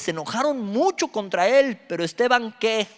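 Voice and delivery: preacher reading Acts to the congregation; fiery scripture reading. Acted. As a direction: read the passage like a sermon at full force. Reads Acts 7 aloud.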